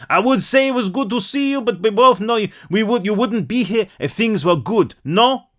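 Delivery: thick French lilt, a knowing jab; heavy French accent. Acted. French baker teasing Herc in a heavy French accent. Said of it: "in a heavy French accent".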